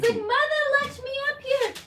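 high put-on dog voice; voicing the dog. Owner voicing her dog begging to be picked up, high squeaky voice. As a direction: speak for the dog in a squeaky plea. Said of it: "He's like".

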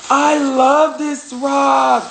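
gasp, then high, loud, stretched-out delight; thrilled gushing. Real, not acted. Speaker finds a beloved rock, thrilled gushing. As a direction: gush it loudly, drawing out the words.

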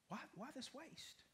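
a hushed mock of a doubter's scorn; scornful hushed question. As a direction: breathe the complaint almost under the breath. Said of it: "those that may not know Jesus would say".

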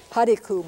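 "Hariku" with a glottal catch at the end; Caddo word demo. Shown as a demonstration. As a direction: say "Hariku", stressing the first syllable. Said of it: "one more example from Caddo".

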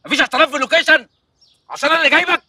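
loud, indignant telling-off; furious director shouting at his crew; angry scolding. Acted.

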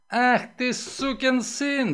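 high, drawn-out curse; angry roar. A narrator's reading. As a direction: roar the insult, stretching it out. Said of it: "взревел он".

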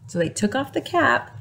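usual speaking voice

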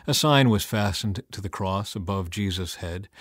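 ordinary delivery

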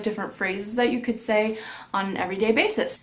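normal talking voice

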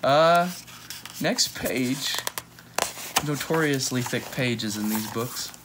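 usual speaking voice

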